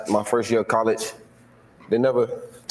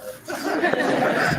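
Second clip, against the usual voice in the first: burst of laughter. Real, not acted. Laughs at his own disbelief.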